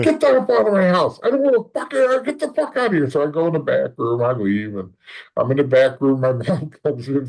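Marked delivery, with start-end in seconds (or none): 0.0-3.1 furious dad. Retold or imitated. Father throwing son out of the house, high mock yell. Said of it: "And he's like".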